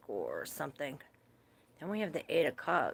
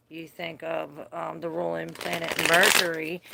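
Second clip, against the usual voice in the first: usual speaking voice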